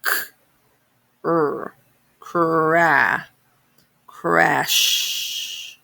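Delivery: crash built up: K, R, cra-, crash; sounding out words. Shown as a demonstration. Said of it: "So let's spell it".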